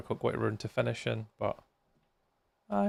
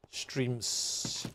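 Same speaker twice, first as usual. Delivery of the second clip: "Streams" said softly with a long hissed final s; stress on the plural; 8 dB quieter than usual. Real, not acted. Streamer correcting friend to more than one, "Streams" with drawn-out s. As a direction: stretch the s ending of "Streams". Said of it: "More than one".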